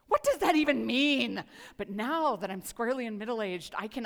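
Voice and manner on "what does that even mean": loud, exasperated mock protest; hammed-up outrage at a cliché; mock indignant shout. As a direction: blurt it out like an annoyed teenager. Mocking her younger angry self.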